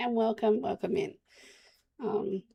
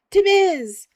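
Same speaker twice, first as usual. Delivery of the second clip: much higher, louder triumphant shout; excited shout; 12 semitones higher, 12 dB louder than usual. Real, not acted. Shouting the coffee shop name to chat, excited. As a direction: blurt it out as it clicks.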